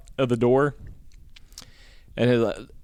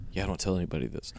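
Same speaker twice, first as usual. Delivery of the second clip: quick, much softer confiding murmur; hushed aside; 5 semitones lower, 11 dB quieter, much faster than usual. Real, not acted. Telling a friend something he shouldn't share, hushed. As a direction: let it slip out low and fast like a secret.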